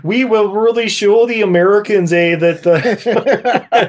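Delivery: put-on high, mangled Russian accent breaking into laughter; mock bad-actress Russian accent; Russian-Wisconsin accent. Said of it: "has a Russian accent by way of Wisconsin".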